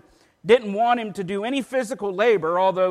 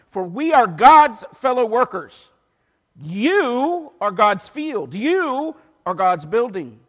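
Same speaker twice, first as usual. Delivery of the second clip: loud, raised, measured pulpit reading; emphatic scripture reading; 4 semitones higher, 7 dB louder than usual. Acted. Preacher reading Paul's letter to church, loud emphatic proclamation. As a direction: proclaim the verses, punching key words.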